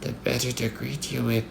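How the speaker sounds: soft, high fake-granny voice; wolf's hushed, high pretend old lady; wolf posing as Grandma. A narrator's reading. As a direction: answer softly, disguised as Grandma.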